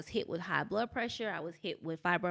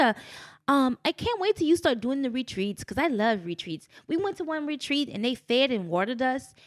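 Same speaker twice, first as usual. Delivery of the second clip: put-on lively, higher-pitched English accent; 5 semitones higher, 5 dB louder than usual. London friend gushes to her about retreats, English accent. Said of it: "English accent. She's like".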